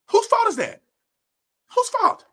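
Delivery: high-pitched indignant challenge; exasperated, very high rhetorical question. Real, not acted. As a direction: demand it with pitch leaping way up. Angry challenge after "makes me so mad.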